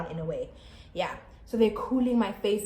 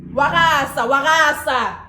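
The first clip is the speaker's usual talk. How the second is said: loud, raised-pitch outcry; exclaiming loudly; 5 semitones higher, 10 dB louder than usual. Retold or imitated. Vlogger reliving walking out at the hospital, loud outcry. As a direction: call it out loud, twice. Exclaims in retelling arrival in pain.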